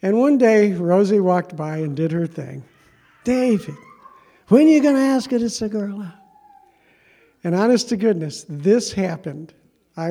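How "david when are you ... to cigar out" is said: much higher, louder put-on Italian accent; Rosie's Italian accent. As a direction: ham up a pushy Italian lady teasing David. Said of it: "It's my imitation of Rosie".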